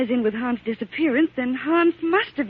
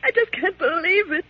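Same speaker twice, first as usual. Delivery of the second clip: crying; 6 semitones higher than usual. Acted. Woman breaks down in tears.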